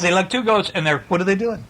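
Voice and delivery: ordinary delivery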